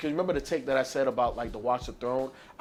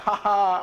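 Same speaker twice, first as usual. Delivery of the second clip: higher-pitched mock "ha ha" laugh; imitated laugh; 6 semitones higher, 3 dB louder than usual. Podcaster recalling the laugh they heard on the track, imitated laugh. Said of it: "the fucking laugh".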